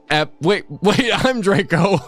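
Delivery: ordinary talk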